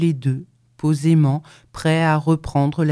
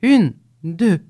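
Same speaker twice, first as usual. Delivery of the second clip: loud, rhythmic count; calling out the step; 4 semitones higher, 4 dB louder than usual. A narrator's reading. Two men carrying the cow, loudly counting their pace. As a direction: count off the marching step aloud. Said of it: "ils criaient".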